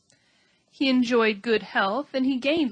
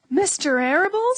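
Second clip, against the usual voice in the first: sobbing; 8 semitones higher, 6 dB louder than usual. A narrator's reading. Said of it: "sobbed Wilbur".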